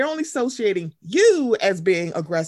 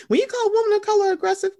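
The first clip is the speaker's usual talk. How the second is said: mocking Jen Shah; 11 semitones higher, faster than usual. Mocking Jen Shah's quote.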